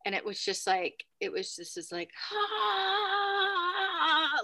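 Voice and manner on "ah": imitated painful cry. Retelling a cry heard outside the window, pained wail. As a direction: mimic a drawn-out pained cry. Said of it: "guttural cry from outside the window".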